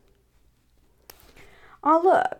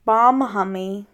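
usual speaking voice

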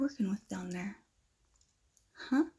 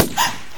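sharp frightened gasp; 8 dB louder than usual. Acted. Hears a gunshot in class.

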